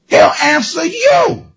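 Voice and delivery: ordinary talk, nothing marked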